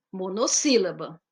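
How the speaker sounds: normal talking voice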